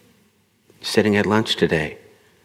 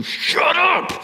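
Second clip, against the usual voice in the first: mock strained shout, pitched up; going in hard. Speaker trying to silence the inner voice, forceful outburst. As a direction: burst out forcefully on the last words. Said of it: "go in hard on that voice".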